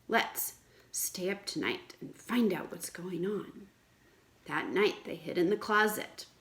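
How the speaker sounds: lively read-aloud story voice; a teacher reading a fairy tale to children; storytelling. A narrator's reading.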